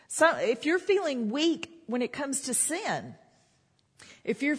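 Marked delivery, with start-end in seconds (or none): none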